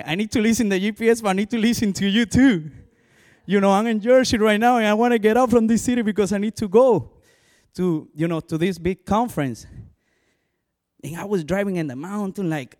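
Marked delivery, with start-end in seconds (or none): 0.0-9.7 high, loud, hurried plea to God; frantic prayer. Retold or imitated. Lost driver pleading with God for directions, loud and frantic. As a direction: pour it out fast and loud.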